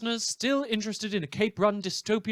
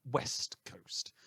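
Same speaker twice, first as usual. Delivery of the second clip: whispered aside; 10 dB quieter than usual. Acted. Dark aside in the news.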